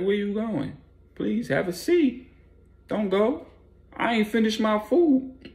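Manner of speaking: high put-on voice begging him to stay; mock polite plea. Bleak fakes polite pleading.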